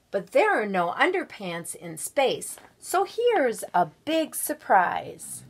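put-on lively read-aloud voice for children; storybook reading. A narrator's reading. Guest reader reading a picture book to schoolchildren, lively storybook voice.

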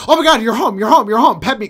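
loud, high, frantic pet-dog greeting; hammed-up yelping excitement; overexcited dog. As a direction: gush like a dog whose owner just got home. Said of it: "a dog would be like".

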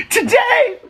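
very high squeaky today. Acted. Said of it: "where I do voices".

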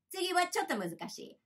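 ordinary talk, nothing marked

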